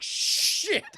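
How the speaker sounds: high, airy panicked curse; mock terror. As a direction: hiss out a high stunned curse. Imitating a scared Dooku.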